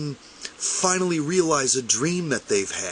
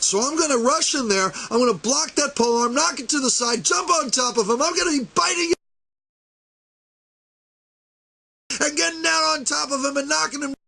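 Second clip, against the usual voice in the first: battle fury; 10 semitones higher, faster than usual. Pretend. Picturing the Russian with the poleaxe, shouting battle fury. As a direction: charge in yelling like you're mid-fight. Imagined fight, charging opponent.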